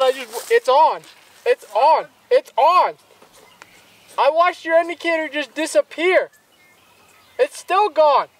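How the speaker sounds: excited shouting, fish on; loud and thrilled as the fish bites; fighting a fish. Real, not acted. Fish hooked on a friend's rod.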